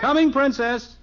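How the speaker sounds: high, loud call to another room; calling back. Acted. Father answering his daughter from upstairs, loud high call. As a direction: call back so she hears you downstairs.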